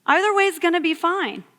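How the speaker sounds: easygoing, as if it's no big deal; casual and unbothered. Retold or imitated. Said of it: "He was just like".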